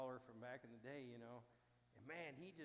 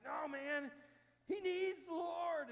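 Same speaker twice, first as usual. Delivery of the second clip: much higher, louder and slow; insistent shout; 14 semitones higher, 13 dB louder, much slower than usual. Retold or imitated. Buddy shouting back at the narrator, insistent and loud. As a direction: plant your feet and insist.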